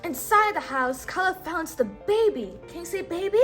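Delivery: ordinary delivery, nothing marked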